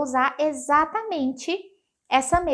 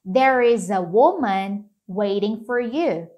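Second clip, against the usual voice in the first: slow teacher model; 4 dB louder, slower than usual. Shown as a demonstration.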